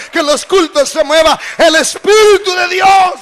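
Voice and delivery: loud, rising preacher's call; full-force pulpit preaching; fiery preaching. Acted. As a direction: preach it out to the congregation.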